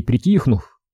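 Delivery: ordinary delivery, nothing marked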